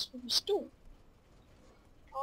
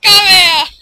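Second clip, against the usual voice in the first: very loud, high scream; screaming taunt; 6 semitones higher, 24 dB louder than usual. Real, not acted. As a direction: scream the taunt at full force. Taunting enemies, louder.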